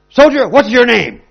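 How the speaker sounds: high, loud demand; commanding shout. Acted. Alexander shouting at the soldier on the floor, a high loud demand. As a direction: bellow it down at him.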